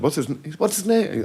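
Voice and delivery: a hint of a squeaky star asking the rat's name. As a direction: pitch it way up and ask sweetly. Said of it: "I can't do his high-pitched voice".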